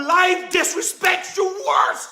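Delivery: high-pitched preacher's cry, slowing down; impassioned rebuke. Real, not acted. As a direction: build to a slow, strained cry on "worse". Preacher's climax rebuking the congregation.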